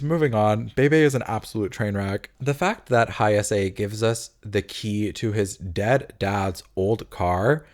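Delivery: normal talking voice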